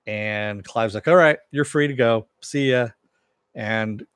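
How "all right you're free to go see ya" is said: loud breezy dismissal. Retold or imitated. Clive dismissing suspect loudly.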